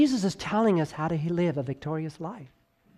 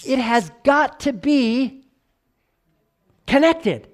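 slow, high and louder, peaking on "connected"; rising emphasis; 7 semitones higher, 6 dB louder, slower than usual. Shown as a demonstration. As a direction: climb up and land hard on "connected". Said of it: "voice fluctuation ... to emphasize".

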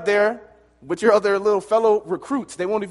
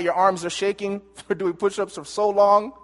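ordinary talk, nothing marked

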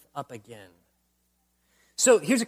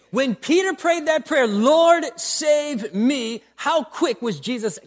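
higher, louder plea of Lord; loud preaching cry; 6 semitones higher, 6 dB louder, slower than usual. Acted.

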